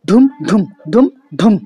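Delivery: four loud, even thumps on ধুম; every beat stressed. Shown as a demonstration. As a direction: pound out each ধুম like a drumbeat. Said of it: "অনেকটা stressed হবে".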